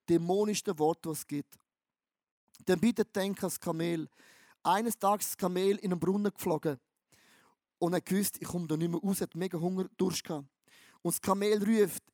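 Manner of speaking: ordinary talk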